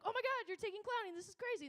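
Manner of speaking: delighted to see friends taking clowning; excited greeting. Retold or imitated. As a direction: squeal at running into friends. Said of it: "And I'm like".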